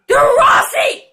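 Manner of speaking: high-pitched, frantic yell at the game; urgent yell. Real, not acted. Player urges his team to cross, yelling.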